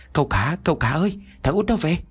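high voice called in from outside; calling from outside. Acted. Servant Tùng warning his master from outside, called from afar. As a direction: shout a warning from out in the yard. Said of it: "từ bên ngoài nói vọng".

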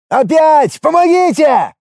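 high, panicked cry; yelling for help. A narrator's reading. Faking another runaway for the crowd, yelling. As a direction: scream for help, loud and high. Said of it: "заорал Ит".

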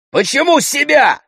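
high, loud shouted question; shouting. A narrator's reading. Brother protests against sister harming herself, shouting. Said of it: "крикнул Андрей".

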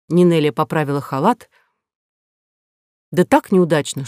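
ordinary delivery

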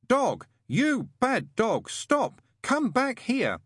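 put-on very high, slow, deliberate boyish calling; clear, distinct shout. A narrator's reading. Adam calls after runaway Dog, shouting clearly and distinctly. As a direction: shout each word separately for show. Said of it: "shouting clearly, loudly and distinctly".

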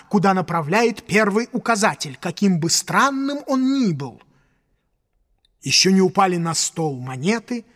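ordinary delivery

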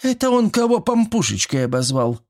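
high, lively granny's question; a nosy old woman perking up at gossip; lively exclaim. A narrator's reading. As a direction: perk up with gossip. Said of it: "оживлённо воскликнула Степанида".

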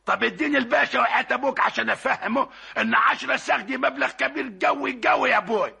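normal talking voice

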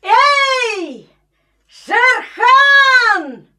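high, slow taunting shout at the enemy; enemy spotted shout. Acted. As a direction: call out a challenge down the ravine. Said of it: "и крикнул во враг". (вызывающий крик врагу)

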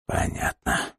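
faint breathy whisper; barely voiced reply; weak whisper. A narrator's reading. Sick man answers weakly.

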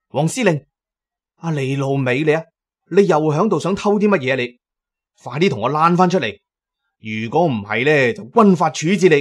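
loud, fast commanding yell; big shout. Acted. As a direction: bellow orders at the hiding friend. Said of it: "於是係大嗌啦". (大聲嗌，語速快，好似發號施令)

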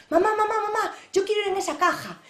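put-on high, loud childish pleading; eager child calling his mother; excited little boy. A narrator's reading. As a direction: tug at mum, begging like a small kid. Child voiced in told story.

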